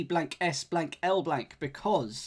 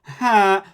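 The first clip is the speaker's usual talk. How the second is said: silly clown honk; 5 semitones higher, 15 dB louder than usual. Pretend. Imitating horn blast.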